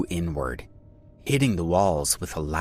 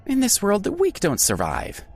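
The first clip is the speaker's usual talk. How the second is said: dismissive; 14 semitones higher than usual. A narrator's reading. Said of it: "Michael said dismissively".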